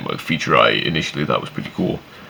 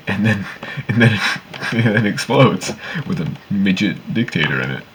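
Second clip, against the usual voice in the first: laughing while talking; 4 semitones higher than usual. Real, not acted. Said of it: "laughing while telling".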